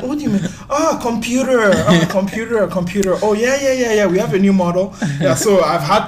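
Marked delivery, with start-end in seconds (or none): 0.0-4.9 high, laughing mock puzzlement; mock confused salesperson; confused shop assistant. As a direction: play the baffled clerk who finally gets it. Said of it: "They're like".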